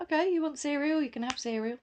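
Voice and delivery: put-on high, sing-song voice to a toddler; sweet to child. Mum answering daughter, high and sweet. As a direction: coo it to a little one. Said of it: "And I was like".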